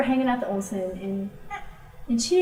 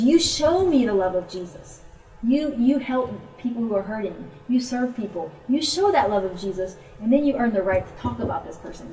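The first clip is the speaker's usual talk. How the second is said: louder imitated exhortation, pitch lifted at first; retold emphatic urging; 3 semitones higher, 5 dB louder than usual. Friend urging the missionary to show love first, emphatic. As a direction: urge it point by point.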